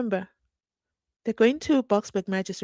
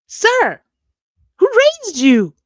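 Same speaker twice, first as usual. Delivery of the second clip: much higher, louder, appalled; indignant outburst; 11 semitones higher, 11 dB louder, much slower than usual. Real, not acted. Vlogger scolding the prosecutor directly, indignant high outburst. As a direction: shout at him like he has no manners.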